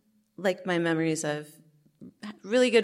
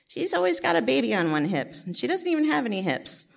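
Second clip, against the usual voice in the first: judgy mom; 9 semitones higher, 4 dB louder than usual. Retold or imitated. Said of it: "I remember one mom saying".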